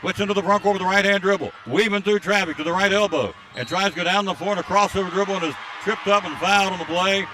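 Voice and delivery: quick, punchy courtside commentary; excited play-by-play. Acted. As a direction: call the rebound and fast break with rising urgency. Live play-by-play burst.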